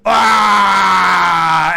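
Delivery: loud alarmed creature cry; startled monster yelp. Acted. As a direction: lizard folk cry out as they flee. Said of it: "These five here just like".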